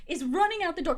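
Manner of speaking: normal talking voice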